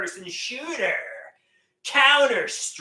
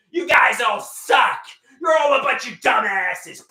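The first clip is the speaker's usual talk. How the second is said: mock yell; 5 semitones higher, 6 dB louder than usual. Insulting his game teammates.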